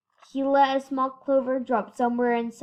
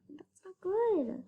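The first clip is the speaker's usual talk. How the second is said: high, slow and soft; slow worried aside; 8 semitones higher, 6 dB quieter, slower than usual. Real, not acted. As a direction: say it slowly with worry. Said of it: "Uh-oh, I hope so".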